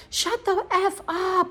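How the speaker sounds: drawn-out high yell at reduced volume; retold scream. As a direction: imitate screaming at someone, slow and held back in volume. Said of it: "I'm screaming at him".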